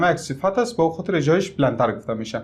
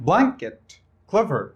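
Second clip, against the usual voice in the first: "blanket" and "clever" with the first syllable high, the second dropping; stress on first syllable; 4 semitones higher, much slower than usual. Shown as a demonstration. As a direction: punch BLAN in "blanket" and CLE in "clever". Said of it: "accent on the first syllable".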